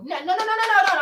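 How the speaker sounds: rapid high repeated no's; insistent denial. Real, not acted. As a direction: shut the idea down fast.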